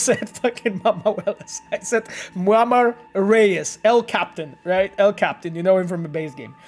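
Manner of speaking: usual speaking voice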